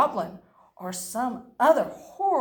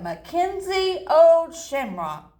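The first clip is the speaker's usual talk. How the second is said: proud loud; 5 semitones higher, 6 dB louder than usual. Acted. Proud name ending.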